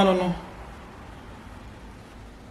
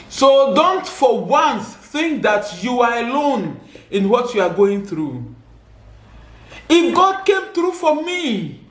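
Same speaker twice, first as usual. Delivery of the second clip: raised, fervent exhortation to the audience; preaching; 3 semitones higher than usual. Acted. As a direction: urge the listeners, rising on each question. Preaching to viewers.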